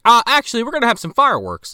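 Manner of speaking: a hint of a pompous mayor announcing fireworks; mock mayor. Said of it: "the fucking mayor just is like".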